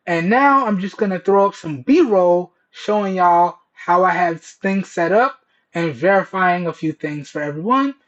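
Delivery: ordinary talk, nothing marked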